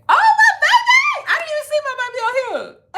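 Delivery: high, loud squeal of joy with a laugh, then fast gushing; sudden thrilled outburst, rushing the words; delighted squeal. Real, not acted. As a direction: shriek with delight at seeing her man. Spots her husband on the stream.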